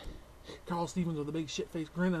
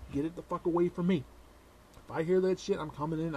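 normal talking voice

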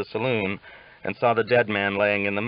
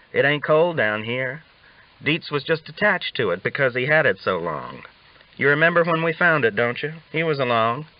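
lightly voiced old ranger, higher and amused; a hint of a cocky old cowhand spinning a yarn; Augustus, teasing; 6 semitones higher than usual.

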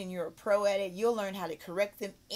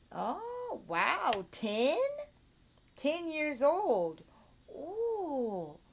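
put-on high, slow, swooping praise for a child; delighted teacher; 5 semitones higher, much slower than usual. Shown as a demonstration. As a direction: react with big wonder to a little pupil. Demo delight at pupil's answer.

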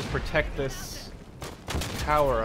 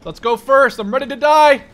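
much higher, louder hyped yelling; wild excitement; 10 dB louder than usual. Real, not acted. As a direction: charge in thrilled. Charging and surviving intense fight.

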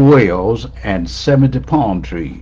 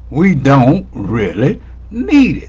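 word by word, "need" set apart; deliberate emphasis. Real, not acted. Preacher repeats the point to congregation, measured and deliberate. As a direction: space out "don't", "really" and "need".

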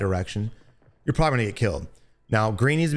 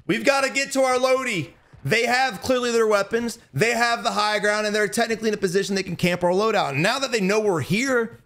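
urgent alarm; 9 semitones higher than usual. Real, not acted. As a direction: stress the danger, tense.

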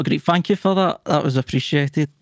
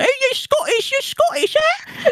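put-on very high-pitched childish heckler; mocking five-year-old. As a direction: mimic a pestering kid, squeaky high. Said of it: "five-year-olds".

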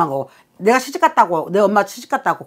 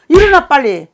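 loud, higher snap to get up; commanding order; 6 semitones higher, 5 dB louder than usual. Real, not acted. 주저앉은 내담자에게 일어나라고 다그치는 명령조. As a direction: jolt her awake with a command.

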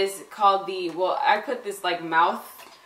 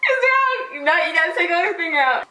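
laughing; 8 semitones higher, 3 dB louder than usual. Real, not acted. Game partner guesses wrong.

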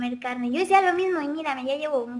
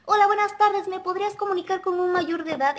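high, louder telemarketer greeting; imitated phone agent; 6 semitones higher, 3 dB louder than usual. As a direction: put on a caller's formal phone pitch. Imitates phone agent calling her. (voz de telefonista, aguda e imitada)

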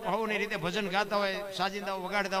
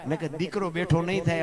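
preaching at full force; 3 semitones higher, 11 dB louder than usual. Acted. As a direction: call out the goddess's names with fervour. Dayro teller builds to devotional climax.

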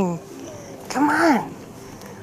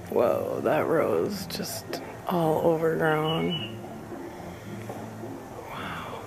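whisper; 7 semitones lower, 15 dB quieter than usual. Real, not acted. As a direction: whisper so nothing hears. Whispering alone among graves.

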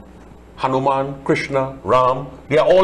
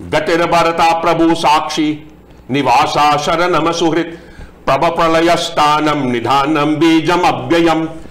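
Sanskrit verse recitation; 2 semitones higher than usual. Acted. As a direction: intone the Sanskrit verse, steady and measured.

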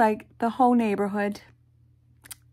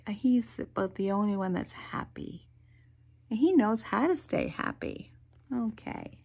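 ordinary delivery, nothing marked

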